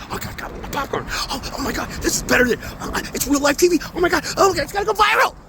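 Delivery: whispered start building to high excited gasps; mock a crowd thrilled by a spectacle; gawking onlookers. Mocking the people who film him, whisper rising to giddy excitement.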